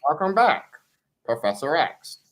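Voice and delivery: put-on voice, high on the greeting, dropping on the name; spirit guides' voice. Guides greet him in the wheelchair, imitated spirit guides' voice. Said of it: "Just like that. They said".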